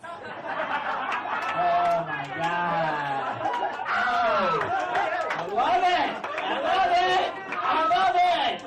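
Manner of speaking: high, loud, drawn-out squeal; gushing delight. Pretend. Comic gushing at matching sweatsuits, high loud squeal. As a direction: gush over it.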